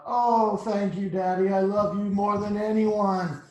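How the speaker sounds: high, loud delighted daughter; hammed-up adoring child; gushing thanks. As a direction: gush it out in one long swell. Daughter gushes thanks to her dad.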